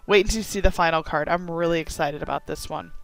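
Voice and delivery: ordinary talk, nothing marked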